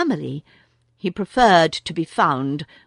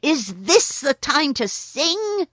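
mild angry scold; 8 semitones higher than usual. A narrator's reading. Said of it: "said Rikki Tikki angrily".

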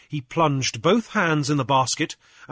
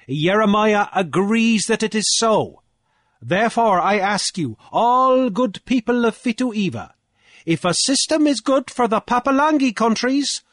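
high, loud declaiming challenge; Cornelius, triumphant orator; 9 semitones higher, 6 dB louder than usual. A narrator's reading. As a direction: orate forcefully to a whole assembly. Cornelius argues before council.

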